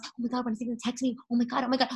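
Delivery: fast, hushed mock panic; quick breathy oh my God mock anxiety; anxious waiting self. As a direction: fret over the phone in a rushed hush. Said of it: "twiddling my thumbs".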